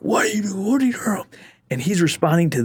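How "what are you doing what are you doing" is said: mock drill sergeant bark, pitched high; a hint of a drill sergeant on the hunt. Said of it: "They're like".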